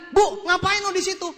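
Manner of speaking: high, loud startled question; astonished call. Retold or imitated. Seller to landlady found eating at the table, astonished call. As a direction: call out loudly in disbelief.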